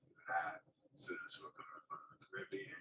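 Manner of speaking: ordinary talk, nothing marked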